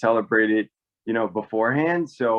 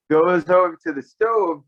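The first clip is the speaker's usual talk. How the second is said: normal talking voice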